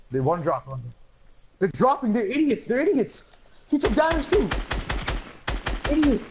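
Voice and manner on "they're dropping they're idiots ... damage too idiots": exasperated yelling. Real, not acted. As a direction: rant at the enemies. Exasperated ranting at enemies.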